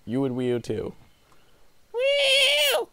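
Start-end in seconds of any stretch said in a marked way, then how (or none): 1.9-2.9 excited whoop. Real, not acted. Cheering jump.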